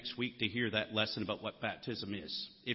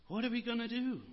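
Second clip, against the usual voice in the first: higher, mock-frightened question; pitched-up imitation of frightened listeners; fearful panic; 10 semitones higher than usual. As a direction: echo the crowd's worried cry. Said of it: "You can almost hear the fear in their voice".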